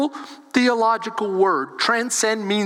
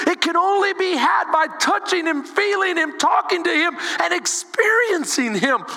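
high, impassioned preacher's delivery; preaching; 6 semitones higher than usual. Real, not acted. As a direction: preach it with full conviction, pitched high. Preaches at full force.

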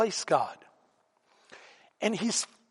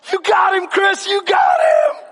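high, loud imitation of little brother; excited yelling; 12 semitones higher, 6 dB louder than usual. Little brother watching the bully go down, excited yelling. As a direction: yell it high, cheering your brother on. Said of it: "my brother starts yelling".

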